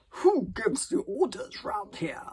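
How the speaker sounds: slow, loud haughty consul; pompous consul. A narrator's reading. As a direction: put him in his place. Consul asserts authority.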